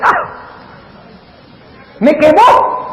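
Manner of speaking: lightly acted cry of a beaten player; dismayed devil. Devil reacting to God's chess move, in mock dismay.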